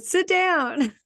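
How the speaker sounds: high, firm little order; firm command. Retold or imitated. Telling the calmed colleague to sit, firm order. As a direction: tell him to sit, amused.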